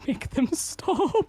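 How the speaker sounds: high, slow, cracking plea. Acted. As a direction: beg with a breaking voice. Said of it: "his voice cracks".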